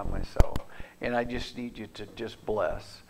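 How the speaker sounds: hushed, whispered plea to God; whispered prayer. Retold or imitated. Pastor retelling his whispered prayer. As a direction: breathe the prayer out softly.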